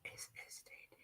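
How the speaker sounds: whispered letters, no voice. Real, not acted. Whispering the letters back to the chat. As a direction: breathe the letters out.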